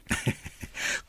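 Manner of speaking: soft unvoiced chuckle; short breathy laugh. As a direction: let a quiet laugh escape. Amused laugh during talk. (mahinang katawa)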